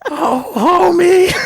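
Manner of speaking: very high, drawn-out cartoon wife's cry; Marge Simpson. Shown as a demonstration. Interviewee doing a requested cartoon impression, high drawn-out wail. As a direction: stretch it out high and loud, like a cartoon housewife calling her husband. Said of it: "your best Marge Simpson impression".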